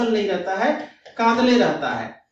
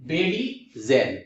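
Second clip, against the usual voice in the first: बेडीजेल slowly, split as Bedi, जेल; low, stretched बेडीजेल with a gap before जेल; broken into parts; 7 semitones lower, much slower than usual. Shown as a demonstration. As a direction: say बेडीजेल in two separated pieces. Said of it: "तोड़िए इसको".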